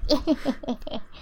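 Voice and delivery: high, private character giggle; giggling to himself. Acted. Maximo amused at his own prank, giggling. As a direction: titter quietly to yourself. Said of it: "Maximo's just giggling to himself".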